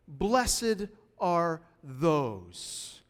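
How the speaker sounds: preacher quoting the Beatitudes to his church, very slowly; very slow quote. Shown as a demonstration. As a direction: stretch "Blessed are those" out word by word. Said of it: "And he goes from".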